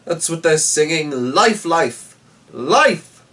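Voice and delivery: loud, high exultant cry; joyful shout. A narrator's reading. Knight exulting over the dead dragon, loud and joyful. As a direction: sing out life.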